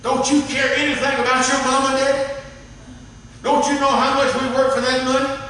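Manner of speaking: high, loud parental tirade; scolding parent. Acted. Parent berates the kid who maxed out the credit cards, loud and high. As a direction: tear into the kid for the debt.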